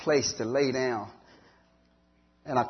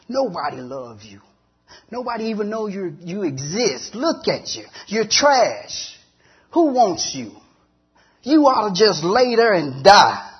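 mocking voices, climbing higher and louder; taunting demons; 6 semitones higher, 8 dB louder than usual. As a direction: sneer like demons tormenting a lost man. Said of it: "demons screaming in my ear".

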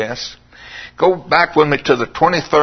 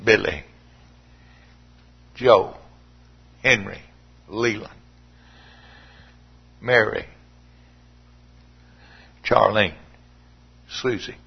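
pleading lament; 3 semitones lower than usual. Retold or imitated. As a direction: mourn over each name as if calling them home. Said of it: "what if he said".

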